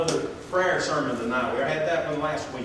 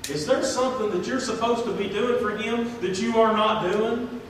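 usual speaking voice

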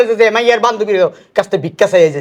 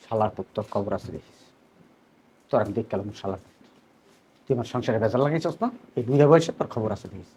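very low, hushed menace; low muttered threat; 12 semitones lower, 12 dB quieter than usual. Acted. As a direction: threaten him under your breath. Muttered threat at the son-in-law. (নিচু গলায় চাপা হুমকি)

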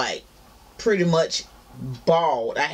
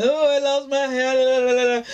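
mock crying; 8 semitones higher, 3 dB louder than usual. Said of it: "be here crying".

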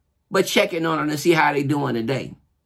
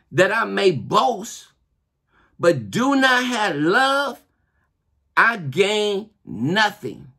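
scripture reading; slower than usual. Acted. Reads scripture slowly.

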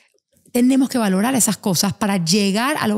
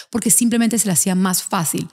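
usual speaking voice

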